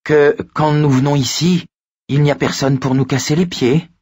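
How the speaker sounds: petite voix aiguë au ton ferme; high, thin child's voice, standing firm; menue mais ferme. A narrator's reading. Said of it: "la voix menue et sifflante, mais le ton ferme". (voix menue et sifflante, mais ferme)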